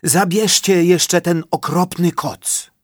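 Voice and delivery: lightly voiced boar; boar's voice. A narrator's reading. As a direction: plead to lose the blanket. Boar asks them.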